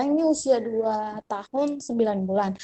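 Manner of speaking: ordinary talk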